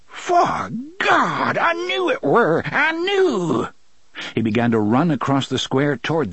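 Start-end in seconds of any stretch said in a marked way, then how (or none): 0.0-3.7 very high-pitched, overjoyed rustic cry; cracked, wavering excitement. A narrator's reading. Si realizes a gunslinger has come, cracked wavering excitement. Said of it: "His voice cracked and wavered with excitement".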